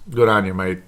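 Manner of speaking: a hint of Aussie, mate said as mait; light Aussie accent. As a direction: slip into a mild Australian accent, Good on you, mate. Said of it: "I pick up the Aussie accent sometimes".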